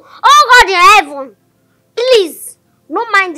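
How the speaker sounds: loud, high mock plea to heaven; mocking prayer. Daughter mimics the parent's prayer.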